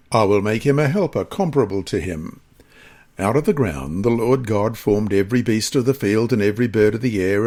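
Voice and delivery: ordinary talk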